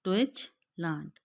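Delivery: teacher models डॉइचलैंड; German tsch sound. Shown as a demonstration. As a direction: say डॉइचलैंड slowly, tsch as one ch. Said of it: "टीएससीएच सिर्फ अकेले एक चे की जो है वो साउंड दे".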